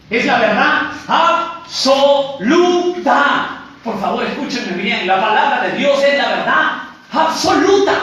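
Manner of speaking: high, loud pulpit proclamation; emphatic sermon cry with pauses; preaching at full force. Real, not acted. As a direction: hammer each key word home, stretched out.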